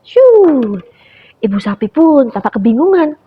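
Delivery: usual speaking voice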